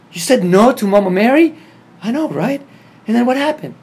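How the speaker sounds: very loud, high-pitched amazement; acted-out incredulous outburst; scandalized disbelief. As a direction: exclaim it, voice shooting way up. Retold outraged amazement at refusal.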